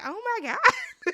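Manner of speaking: high, slow, half-laughing "oh my God"; mock the room's stunned reaction; stretched-out laughing disbelief; shocked gasp. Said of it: "Everybody is looking like".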